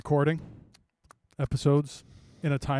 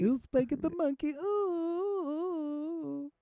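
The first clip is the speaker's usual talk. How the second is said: high, softer cartoonish voice; silly falsetto; 13 semitones higher, 7 dB quieter, much slower than usual. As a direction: squeak it, sliding into a long ooh. Silly reply to monkey joke.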